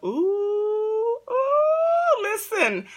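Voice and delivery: soaring high, drawn-out ooh; high squealing ooh. Real, not acted. Cooing high to her viewers about love. Excited exclamation before confiding story.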